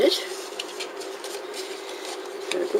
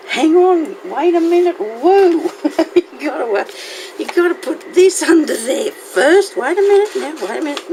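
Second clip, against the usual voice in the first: flustered excitement; 10 semitones higher, 3 dB louder than usual. Real, not acted. Panicky rush to fix craft.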